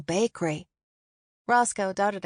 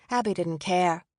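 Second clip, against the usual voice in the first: ordinary delivery, nothing marked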